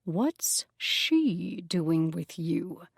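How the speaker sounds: very slow menacing question; slow threat to the nymph; Cyclops, slow and menacing. Acted. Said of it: "eye became menacing".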